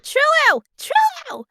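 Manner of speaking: loud, high call of the name. Real, not acted. Calling Trillo to stop drinking, loud and high. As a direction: shout her name to stop her.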